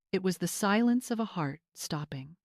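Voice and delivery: ordinary delivery, nothing marked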